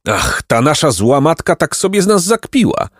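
high, indignant exclamation; astonished. A narrator's reading. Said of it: "wykrzyknął zdumiony Placek".

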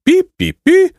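very high "pi, pi, pi" peeps; playful high-pitched cheeping; delighted maidens' squeal. A narrator's reading. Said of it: "uradowały się dziewice".

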